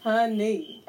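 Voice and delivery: ordinary delivery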